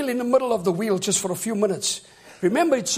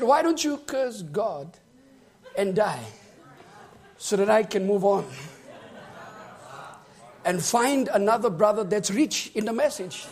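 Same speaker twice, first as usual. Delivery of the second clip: wife's taunt; 3 semitones lower than usual. Retold or imitated. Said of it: "She said".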